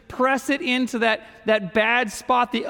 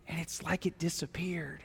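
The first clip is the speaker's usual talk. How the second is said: softer, hushed wonder; awed whisper; 3 semitones lower, 14 dB quieter than usual. Real, not acted. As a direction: whisper it as if it vanished. Paint vanishing, hushed wonder.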